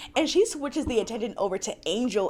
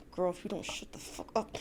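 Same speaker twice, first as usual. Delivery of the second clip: much softer, low threat through the teeth; hushed warning; 6 semitones lower, 13 dB quieter than usual. Retold or imitated. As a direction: mutter a quiet warning to shut up. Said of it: "looking at her like".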